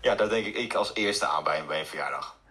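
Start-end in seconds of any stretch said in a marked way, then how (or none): none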